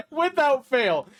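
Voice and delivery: slow laughing without fail. Real, not acted. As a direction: drag it out giggling. Laughing while telling.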